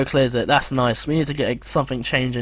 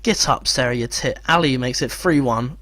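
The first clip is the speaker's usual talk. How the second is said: normal talking voice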